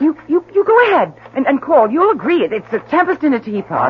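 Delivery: high, fast, tripping over words; agitated stammer. Acted. Wife daring her husband to call the neighbours, flustered and fast. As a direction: bluster to cover panic.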